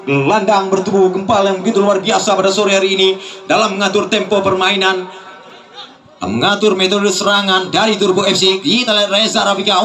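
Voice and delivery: ordinary talk, nothing marked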